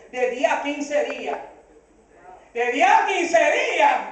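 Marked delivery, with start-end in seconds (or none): 2.5-4.0 slow, higher, drawn-out disbelief; incredulous echo. Retold or imitated. Pastor echoing the bank's waiting time back, incredulous. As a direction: throw it back as an outraged question.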